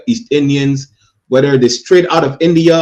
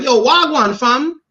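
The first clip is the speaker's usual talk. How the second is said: high, mocking Toronto Jamaican slang; Jamaican accent; 11 semitones higher, slower than usual. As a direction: imitate a wannabe Jamaican from Toronto. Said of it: "you gonna be like".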